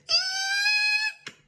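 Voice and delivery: lion lets out a tiny scared eek; frightened eek. Acted. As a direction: squeal like a startled lion. Lion squeals instead of roaring.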